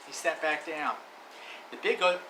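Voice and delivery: plain reading voice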